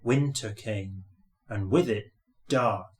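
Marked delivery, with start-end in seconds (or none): none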